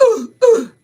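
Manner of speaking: a loud, sudden gasp for air; sharp gasp. Real, not acted. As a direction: gasp like crying has emptied your lungs. Crying so hard, out of breath.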